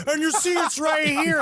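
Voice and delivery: high, shouted imitation of the man; mock UFO man. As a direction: ham up the crazy UFO guy.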